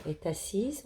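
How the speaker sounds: "Est assise" linked with a sounded t; liaison. Shown as a demonstration. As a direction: run "Est" into "assise" through the t. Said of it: "On fait la liaison". (liaison en t entre « Est » et « assise »)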